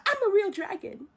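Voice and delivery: high, loud mock outrage; acted high-pitched indignation; indignant protest. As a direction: protest loudly as an offended little dragon. Mushu protests being slighted.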